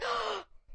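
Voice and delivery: sharp mock gasp; flustered gasp. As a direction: gasp as if caught off guard.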